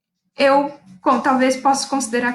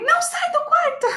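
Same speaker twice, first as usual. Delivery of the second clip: retold command, much higher and laughing through it; laughing bossy order. Telling her mother to leave the room, a laughing mock command. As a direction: bark it half laughing at the memory. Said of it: "eu fiquei muito autoritária".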